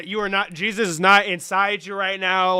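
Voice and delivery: ordinary talk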